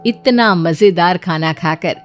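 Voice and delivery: ordinary delivery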